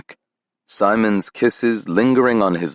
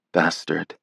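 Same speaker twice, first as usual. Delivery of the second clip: soft, tender coo; 2 semitones lower, 5 dB quieter than usual. A narrator's reading. Grandson to grieving grandmother, softly cooing. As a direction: coo it gently in solidarity. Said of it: "he cooed in solidarity".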